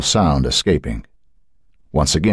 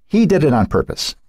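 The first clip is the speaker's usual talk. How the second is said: put-on high, piping old woman's voice; a frail grandmother insisting, piped high; 11 semitones higher than usual. A narrator's reading.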